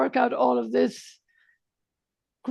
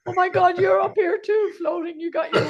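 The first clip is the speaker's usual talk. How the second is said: hammed-up joy at spotting a friend, laughing at the end; excited mock greeting; 6 semitones higher than usual. Host pretends to greet his guest after death, excited and laughing.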